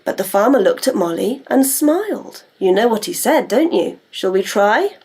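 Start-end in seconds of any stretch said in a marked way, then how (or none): none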